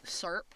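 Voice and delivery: "Serp" said as one clipped syllable for syrup; imitating dad's syrup. Mocking her dad's word, "Serp" in one syllable. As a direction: say "Serp", dropping the middle vowel of syrup. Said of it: "That's how he says syrup".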